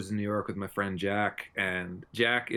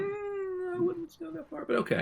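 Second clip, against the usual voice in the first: high squeaky voice. Pretend. Skeptical high mock voice.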